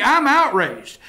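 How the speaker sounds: hammed-up rowdy fan pleading in a high voice; high, excited good-old-boy whine; outraged Texas guy. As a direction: holler encouragement at the stage in mock outrage. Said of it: "we found the one guy in Texas that's outraged".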